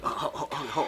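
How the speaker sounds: ordinary talk, nothing marked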